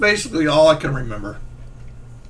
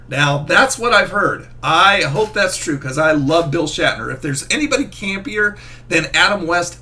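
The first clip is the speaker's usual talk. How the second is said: ordinary talk, nothing marked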